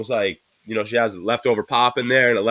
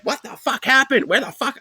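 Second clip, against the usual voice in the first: retold scolding, pitched way up; imitating angry wife; 11 semitones higher than usual. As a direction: play the exasperated wife demanding answers. Retelling wife's angry morning questioning.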